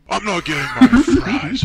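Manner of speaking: loud, high, laughing yell; big laughing whine about fries; laughing shout. Acted. Shouted complaint.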